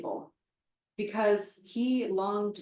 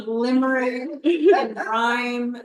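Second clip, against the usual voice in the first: laughing; 4 semitones higher, 10 dB louder, much slower than usual. Real, not acted. Laughing about poet's parody.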